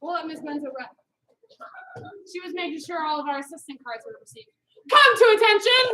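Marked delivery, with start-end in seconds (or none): 4.9-5.8 loud, high command; command call. Real, not acted. Instructor calling her class to attention, loud. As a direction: bark the drill command.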